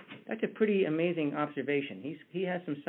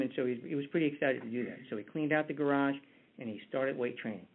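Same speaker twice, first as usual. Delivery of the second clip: ordinary talk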